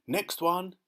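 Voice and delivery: high, loud call; shout. Acted. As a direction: call them to the next one. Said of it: "he shouted".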